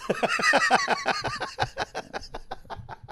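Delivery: high burst of laughter at own joke; cackling at his own insult; high squealing laugh. Real, not acted. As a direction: crack up, high and long.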